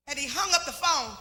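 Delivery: normal talking voice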